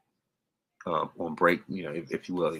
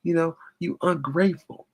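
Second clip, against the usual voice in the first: high falsetto mock yell; theatrical high-voiced accusation; imitated yelling; 12 semitones higher than usual. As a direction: act out calling after someone, high-pitched. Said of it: "she was just yelling out".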